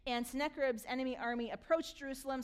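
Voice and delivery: usual speaking voice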